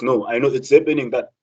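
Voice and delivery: normal talking voice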